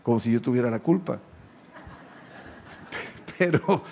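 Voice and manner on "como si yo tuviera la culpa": normal talking voice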